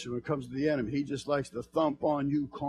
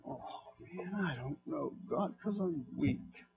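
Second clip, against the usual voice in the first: 1 quiet weak confession; 11 dB quieter, slower than usual. Pretend. Voicing weak believer to God.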